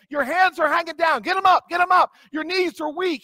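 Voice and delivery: football coach. Retold or imitated. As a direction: shout it like a coach. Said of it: "It sounds like my football coach".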